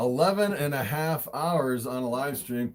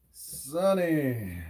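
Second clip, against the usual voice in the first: normal talking voice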